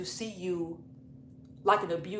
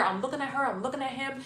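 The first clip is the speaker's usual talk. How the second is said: ordinary talk, nothing marked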